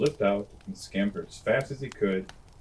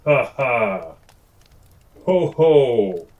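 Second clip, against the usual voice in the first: loud mock ha ha ho ho; booming lion laugh; 10 dB louder, much slower than usual. Amused lion laughs at the tiny mouse, loud booming laugh. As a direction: laugh big and long like a lion. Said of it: "He laughed so loud".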